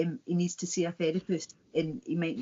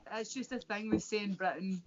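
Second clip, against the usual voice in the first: ordinary talk, nothing marked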